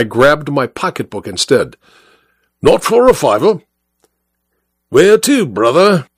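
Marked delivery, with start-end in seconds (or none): 2.6-6.1 higher, declaiming working-class cabman; union orator. Acted. Cabby lectures the passenger on working-class solidarity, higher oratorical voice. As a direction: orate about solidarity like a soapbox speaker. Cabby bargained over the fare.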